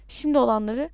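ordinary delivery

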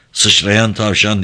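plain reading voice

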